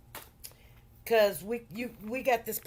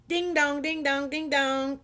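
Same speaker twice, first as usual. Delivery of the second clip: chiming ding-dongs, pitched up a little; imitated doorbell; 4 semitones higher than usual. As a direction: mimic an insistent doorbell in a steady chant. Imitates doorbell as visitor arrives.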